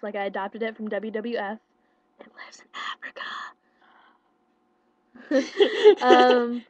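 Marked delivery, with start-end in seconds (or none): 2.2-3.2 breathy whispered aside, no voice; confiding whisper tossed in. Real, not acted. Whispered aside about giraffe. 5.3-6.0 loud high laugh; laughing hard at her own joke; burst of laughter. Real, not acted. Laughs after giraffe joke.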